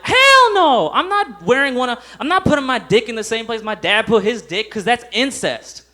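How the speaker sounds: high, loud outraged refusal; a hammed-up horrified yell at mom; outraged shout. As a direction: yell it back at mom, appalled.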